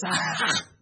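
loud mimicked raspy gasp; breathless preacher gasp. Showing the crowd how the preacher gasped, loud wheezy gasp. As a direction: heave out an exaggerated "hah" for breath. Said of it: "he has this problem getting breath".

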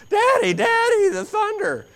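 put-on high, loud frightened child's cry; a little kid waking dad in a storm; scared child screaming. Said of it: "they scream".

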